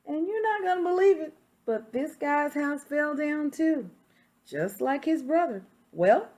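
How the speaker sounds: reading a picture book aloud as the wolf; story narration. Acted. Teacher reading to children, storytelling voice. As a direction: tell it to the class like the wolf's own tale.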